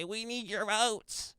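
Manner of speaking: high mock pleading politician voice; hammed-up high begging plea; mocking Democrats. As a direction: beg for votes in a high whiny voice. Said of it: "they hold out their hats and they say".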